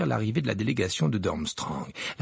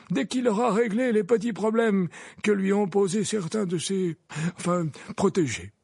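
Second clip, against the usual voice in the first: put-on higher, pausing old man; old wizard voice; 9 semitones higher than usual. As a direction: hesitate over the word. Dumbledore voiced.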